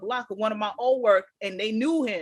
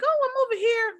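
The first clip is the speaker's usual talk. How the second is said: fake innocent; 12 semitones higher than usual. Lying innocently on phone.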